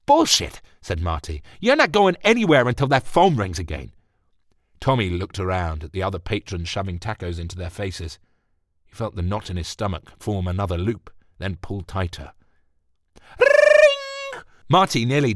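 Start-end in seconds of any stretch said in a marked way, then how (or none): 0.1-3.9 high, loud angry retort; angry outburst. Acted. Marty stops him angrily. 13.4-14.4 very high, loud ringing phone; phone ring imitation.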